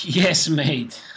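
loud, high "Yes" with a falling tune; shouted catchphrase. Acted. Intro catchphrase, loud second try.

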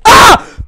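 high loud yelp of fright; startled scream. Real, not acted. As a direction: shriek at a sudden scare. Jump scare in horror game.

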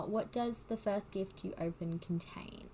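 ordinary delivery

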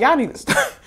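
high, laughing imitation of the mechanic; laughing mock scolding. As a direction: say it through a laugh, teasing.

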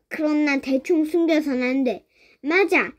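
ordinary delivery, nothing marked

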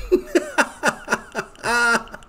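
long, high cackle; high-pitched belly laugh. Real, not acted. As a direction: burst out laughing helplessly. Cracking up at the played clip.

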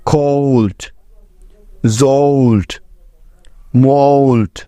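কোল্ট with a gliding "oh-oo" vowel; one word at a time, the ও in জোল্ট said as "ow"; teacher modelling the "olt" sound; clear model pronunciation. Shown as a demonstration. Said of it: "যেমন".

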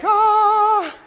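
high drawn-out "aah"; imitated wail. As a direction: mimic her with a long, high wail. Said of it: "Looking all like".

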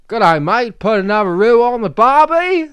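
high, loud mock Aussie; bad Australian accent. Shown as a demonstration. Asked for Australian by his friend, loud high mock Aussie accent. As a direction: ham up a cartoon Aussie. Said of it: "about Australian?".

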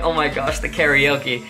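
ordinary talk

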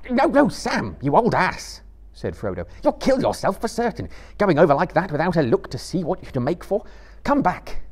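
high, loud, urgent hobbit cry; alarmed shout. A narrator's reading. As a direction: stop a friend from a deadly fall. Frodo stops Sam in alarm.

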